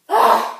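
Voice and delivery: loud, high "ugh" of frustration; explosive groan of disgust; exasperated groan. Acted. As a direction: let out a loud, fed-up groan. Exasperated end of phone quarrel.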